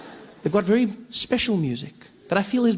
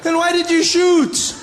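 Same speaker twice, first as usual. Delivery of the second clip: high loud slow outraged shout; loud high indignant yelling; 9 semitones higher, 4 dB louder, slower than usual. Retold or imitated. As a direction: demand an answer, furious. Indignant shot man.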